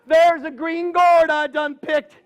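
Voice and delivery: put-on high, panicky old woman; frightened old lady. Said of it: "She says".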